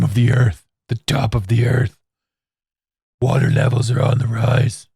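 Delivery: low gravelly-free caped-crusader growl, lightly done; a comical hushed Batman delivery. Shown as a demonstration. Ranting about rising seas in a mock Batman voice. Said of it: "This is me doing my best Batman voice".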